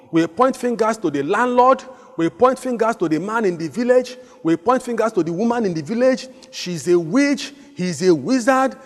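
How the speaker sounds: high, loud preaching build; preaching at full force. Acted. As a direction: lift it up and drive it home to the congregation. Preacher raises voice.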